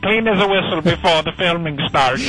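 broad comic Irish brogue; put-on Irish accent. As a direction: play a jokey stage Irishman with a brogue.

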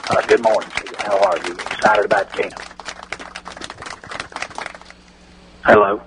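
a hint of the Cowboys owner on a bad phone line; Jerry Jones impression. Radio bit, owner greeting the hosts, light folksy impression. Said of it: "let's welcome Jerry Jones".

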